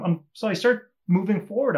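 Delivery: ordinary talk, nothing marked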